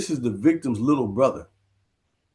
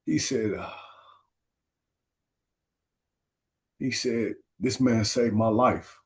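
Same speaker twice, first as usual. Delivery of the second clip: much softer, halting, long pauses; barely holding it together to get the words out; tearful whisper-quiet retelling; choked up; 5 dB quieter than usual. Real, not acted. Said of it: "I'm getting emotional".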